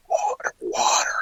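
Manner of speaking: eerie whisper, unvoiced breath only, imitating a recorded voice; whispered ghost voice. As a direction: imitate a faint voice on tape, whispered. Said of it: "just like that".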